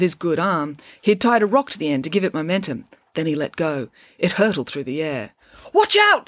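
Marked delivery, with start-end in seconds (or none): 5.7-6.3 high, loud urgent warning; warning shout. A narrator's reading. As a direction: cry out before the rock hits. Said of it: "cried Ian".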